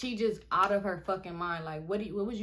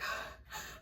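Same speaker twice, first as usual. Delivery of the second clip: unvoiced, shuddering breath; acted panicky gasp; 10 dB quieter than usual. Storyteller showing her fright after shots, acted gasp. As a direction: gasp as if terrified.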